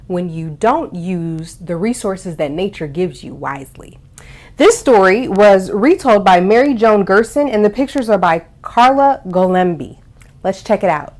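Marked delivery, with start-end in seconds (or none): none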